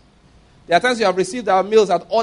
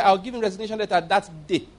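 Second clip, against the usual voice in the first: usual speaking voice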